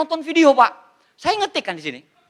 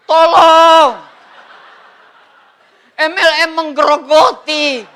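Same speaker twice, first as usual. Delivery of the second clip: mock anguished plea; 4 semitones higher, 3 dB louder, slower than usual. Mock desperate plea to God.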